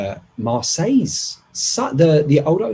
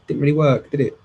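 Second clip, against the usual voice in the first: usual speaking voice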